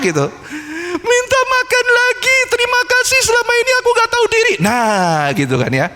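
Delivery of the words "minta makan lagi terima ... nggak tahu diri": put-on a child saying sorry to get his meals back; a small child humbly begging forgiveness; pleading child. Child apologizing to parents.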